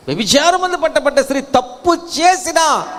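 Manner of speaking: high, emphatic pulpit cry; impassioned preaching. Real, not acted. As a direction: build fervently to the point. Preacher building to climax.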